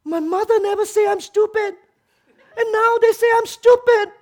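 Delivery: high, loud whiny complaint; whiny hurt kid. Retold or imitated. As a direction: wail like a spoiled grown kid. Grown kid whining at being scolded.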